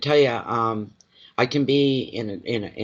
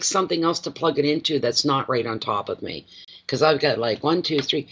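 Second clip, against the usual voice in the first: ordinary talk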